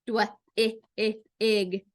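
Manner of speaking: dwig broken into separate sounds dw-, i, ig; segmented phonics demo of dw-, i, ig; sounded out. Shown as a demonstration. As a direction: say the blend, then the short i, then ig, with gaps. Teacher sounds out a blend word.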